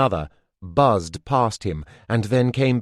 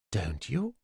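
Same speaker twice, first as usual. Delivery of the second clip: much softer, teasing little question; soft and coy; 10 dB quieter than usual. A narrator's reading. Molly challenging Redbeard with her bees, soft and coy. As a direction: hush it, coyly baiting him. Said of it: "asked softly, almost coyly".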